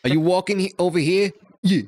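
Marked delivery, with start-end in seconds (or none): 1.6-1.8 mock New York accent, r-less and raised; playful, higher New York street talk. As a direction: put on a New Yorker's challenge. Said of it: "That's a real New York yeah".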